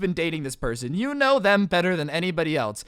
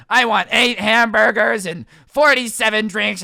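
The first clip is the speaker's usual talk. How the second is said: angry Hobbit-like customer; 2 semitones higher, 8 dB louder than usual. Retold or imitated. Old man barks a huge drive-thru order, angrily. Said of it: "getting angrier and angrier with his Hobbit language".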